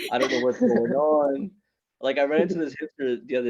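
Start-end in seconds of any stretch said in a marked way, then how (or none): 0.1-1.5 very high, loud, comic outcry; exasperated bewilderment. Acted. Comic confused by hipsters about who anyone is, hammed-up bewilderment.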